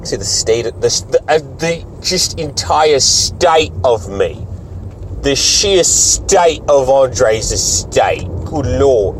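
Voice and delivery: slow, drawn-out mock English accent. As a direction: put on an exaggerated English accent, drawing out STATE. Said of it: "Shouldn't have done English accent".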